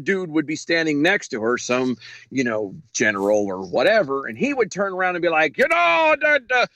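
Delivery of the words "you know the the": mock Iranian accent. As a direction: ham up an Iranian general's accent speaking for the president. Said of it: "how do you not do the accent right".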